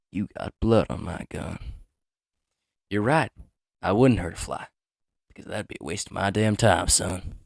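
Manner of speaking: slow, loud Southern cowboy drawl; a hammed-up Southern gunslinger, loud and slow; dramatic southern accent. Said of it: "read in a, uh, dramatic, uh, southern accent".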